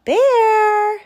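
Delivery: high, loud call into the cave. Acted. Porcupine calls into Bear's cave, a loud high call. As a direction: hail Bear from outside his cave. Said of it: "she called".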